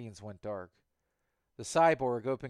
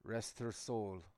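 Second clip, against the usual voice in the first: soft and slow; quiet; 7 dB quieter, slower than usual. Acted. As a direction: say it softly, slowly. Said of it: "her voice quiet".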